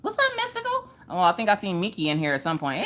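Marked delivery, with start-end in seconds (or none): 0.0-0.9 falsetto greeting. Real, not acted. Greeting viewers in sing-song falsetto.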